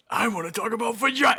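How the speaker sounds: high, fast mocking squeak. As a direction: toss off the silly quote. Repeats the mocking quote.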